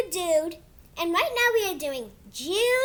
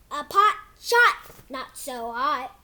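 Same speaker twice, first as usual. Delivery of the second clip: put-on reading to kids; 5 semitones lower than usual. Kid reading his book to viewers in storybook voice. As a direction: read it like a bedtime book for little ones. Reads picture book aloud.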